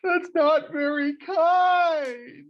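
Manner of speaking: put-on high, slow, wailing little-boy cry; tearful child. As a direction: imitate the sobbing boy, hurt and loud. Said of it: "And he just said".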